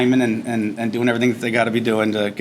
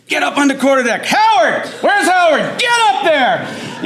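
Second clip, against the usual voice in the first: much higher, very loud barked orders; drill instructor yelling; 5 dB louder, slower than usual. Retold or imitated. Drill instructor yells at Howard to get to the quarterdeck, screaming. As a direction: scream the orders across the deck. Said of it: "just scream at you".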